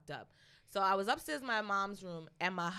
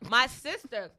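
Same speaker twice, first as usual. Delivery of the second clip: normal talking voice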